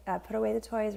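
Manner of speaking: ordinary delivery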